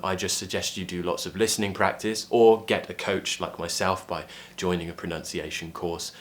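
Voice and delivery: usual speaking voice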